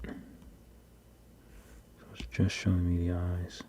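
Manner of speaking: muffled behind a hand; hand over mouth. Real, not acted. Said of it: "I shouldn't talk with my hand over my mouth".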